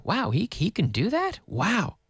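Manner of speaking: high, incredulous question on a mock "wow"; amazed. Teacher reacts to the abnormal feat, play-acted amazement. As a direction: marvel at it, pitch swooping up.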